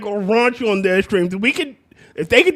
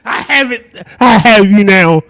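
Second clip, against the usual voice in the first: loud, drawn-out gloating catch; mock captor; 8 dB louder than usual. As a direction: play the villain who has caught someone. Acts out a gotcha interrogator.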